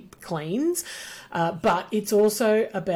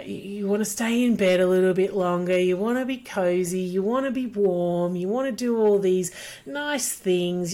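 usual speaking voice

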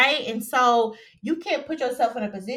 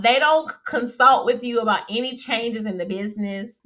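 usual speaking voice

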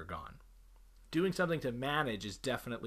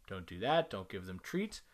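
normal talking voice

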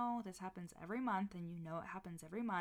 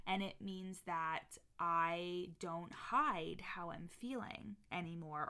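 ordinary delivery

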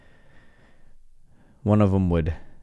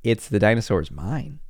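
ordinary talk, nothing marked

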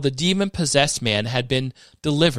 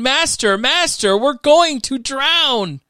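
high, raised cry, last words drawn out; urgent shouting; 11 semitones higher, 4 dB louder, slower than usual. A narrator's reading. Disciples waking Jesus in the storm, urgent shouting.

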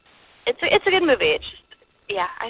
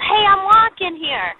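higher, drawn-out quoted yell; mock yell; 8 semitones higher, slower than usual. Caller quoting a man nearly run over by a cab, mock yell. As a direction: yell it out, stretched and higher, at moderate volume. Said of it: "he yelled".

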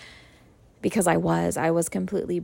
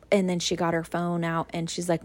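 ordinary delivery

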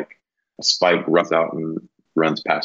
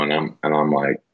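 ordinary talk

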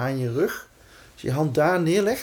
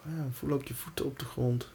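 soft, reassuring meditation voice; guided meditation; 4 dB quieter than usual. Acted. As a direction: calmly guide them into safety. Guides breathing and self-talk.

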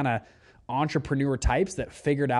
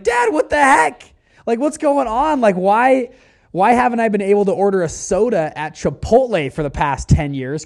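much higher and louder, stunned and demanding; shocked outburst; 8 semitones higher, 9 dB louder than usual. Retold or imitated. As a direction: burst in on dad, disbelieving. Confronting his dad, shocked.

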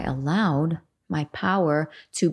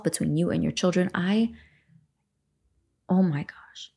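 ordinary talk, nothing marked

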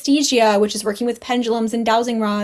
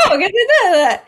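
laughing through the words, higher; 10 semitones higher, 4 dB louder than usual. Real, not acted. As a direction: giggle out the realisation. Laughing at her own reveal.

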